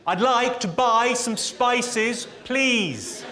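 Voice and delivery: drawn-out loud English abroad; loud and slow for the foreigner. Acted. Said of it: "Say it a bit louder".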